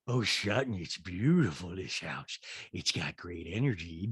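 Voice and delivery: dropped-pitch send-up of a gushing guest; Rinna. Retold or imitated. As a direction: draw the praise out low and soft. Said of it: "sorry, Rinna says".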